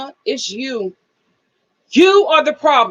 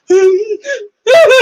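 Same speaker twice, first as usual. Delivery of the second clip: laughing cry; 9 semitones higher, 9 dB louder than usual. Real, not acted.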